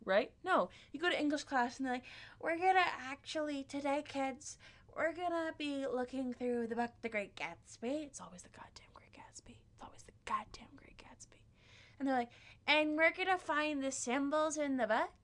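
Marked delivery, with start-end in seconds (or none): 2.4-8.0 higher-pitched, put-on teacher voice; English teacher impression. Teacher introduces The Great Gatsby to kids, high mock-teacher voice. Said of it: "This is indeed my impression of my, um, eleventh grade English teacher". 8.1-11.4 unvoiced, exasperated whisper; whispered aside. Student grumbles about always getting Gatsby, whispered.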